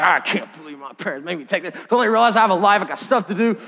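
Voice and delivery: a lightly voiced sulky teen complaining; grumbling teen. Son griping while taking out the trash, lightly voiced sulking. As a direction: grumble like a put-upon kid. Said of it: "the whole time he's like".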